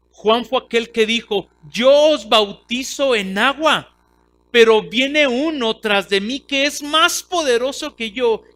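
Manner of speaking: full-force preaching. Acted. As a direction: drive the point home to the congregation. Preaching John's words.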